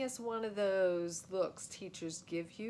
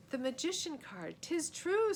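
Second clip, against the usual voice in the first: lady's voice; 9 semitones higher, 4 dB louder than usual. Acted. Queen marvels.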